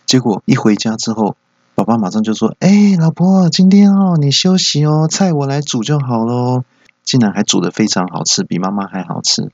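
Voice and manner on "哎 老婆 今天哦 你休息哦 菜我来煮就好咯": high, loud coaxing to the wife; butter her up, syrupy and eager; husband coaxing his wife, mock sweet; sweet-talking. Dad sweet-talks wife.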